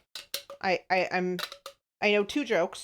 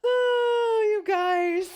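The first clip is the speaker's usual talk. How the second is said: overjoyed squeal; 12 semitones higher, much slower than usual. Real, not acted. Thrilled that a viewer named Pupper laughed at the joke, squealing with joy. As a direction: cheer like you just won.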